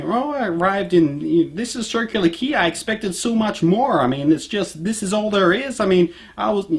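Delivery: high, whiny complaining imitation; mocking whiny American tourist. Mimicking American women complaining about the harbour, whiny.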